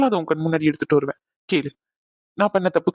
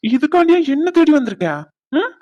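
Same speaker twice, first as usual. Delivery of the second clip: teasing exclaim; 8 semitones higher, 5 dB louder than usual. Acted. Teasing her for coming to find him.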